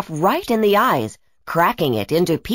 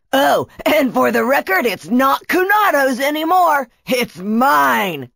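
boastful villain; 6 semitones higher than usual. Acted. Brachus claims Kunatos's staff as his own, gloating. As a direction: claim the prize with relish.

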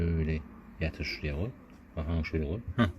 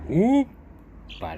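high, loud, long drawn-out hoppala; playful hoppala; 9 semitones higher, 5 dB louder, much slower than usual. Pretend. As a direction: stretch it out like lifting a baby. Lifting the baby animal up playfully.

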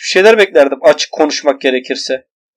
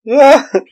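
high burst of laughter; 9 semitones higher, 5 dB louder than usual. Real, not acted. Laughs at game mishap.

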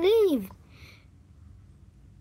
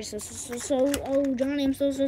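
usual speaking voice

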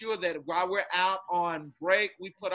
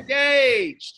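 loud single-word chant; call-out shout; 5 semitones higher, 9 dB louder than usual. Shown as a demonstration. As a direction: shout it for kids to echo. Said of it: "continue to repeat after me".

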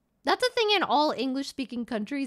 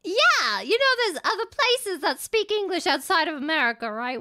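mock-superior English accent; 4 semitones higher, 3 dB louder than usual. Streamer lecturing co-host on English-speaking places, English accent. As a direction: correct them in an English accent. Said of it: "isn't that English accent".

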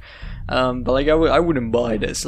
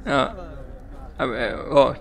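usual speaking voice

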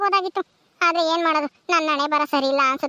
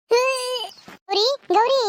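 long drawn-out high call of Gauri; Gauri held long and high; 5 semitones higher, much slower than usual. Acted. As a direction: stretch Gauri out as if calling across the yard. Calling friend from afar.

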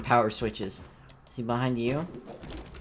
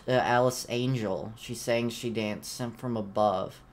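ordinary talk, nothing marked

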